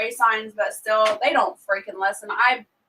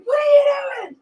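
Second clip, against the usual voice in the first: high, loud alarmed shout; shocked shout; 6 dB louder, faster than usual. Retold or imitated. Mom yelling at her daughter on video chat, shocked. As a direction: yell it in alarm.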